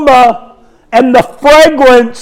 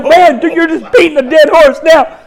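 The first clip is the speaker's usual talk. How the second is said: mock self-scolding; 5 semitones higher than usual. Scolding himself in his head.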